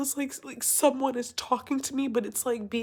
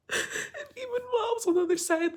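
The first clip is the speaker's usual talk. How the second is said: high, rushed, tearful joy; 9 semitones higher, much faster than usual. Real, not acted. Storyteller moved recalling her bliss, tearful. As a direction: push on through happy tears.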